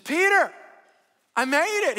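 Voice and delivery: high, loud, thrilled greeting; gleeful, high-pitched announcement; excited arrival. Acted. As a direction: cry out in triumph to an old friend. Joke: woman arrives at heaven's gate.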